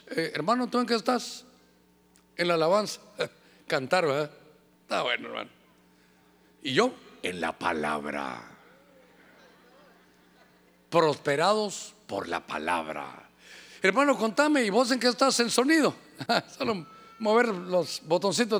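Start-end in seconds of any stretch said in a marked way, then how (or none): 4.8-13.3 mock pompous holy voice; pompous preacher. Mocking his own proud past self.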